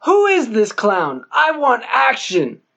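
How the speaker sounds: the mayor fuming at his police chief; barking mayor. Acted. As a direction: bark out demands for action. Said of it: "barked the mayor".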